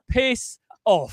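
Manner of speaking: drawn-out English send-off; best English accent. Acted. Caller cursing fans who dislike winning, English accent. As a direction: swear at them in deliberate English tones. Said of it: "in my best English accent".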